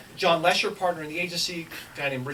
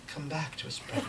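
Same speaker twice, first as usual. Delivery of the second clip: gentle plea; 2 semitones lower, 10 dB quieter than usual. Retold or imitated. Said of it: "she looked me in the eyes and she said".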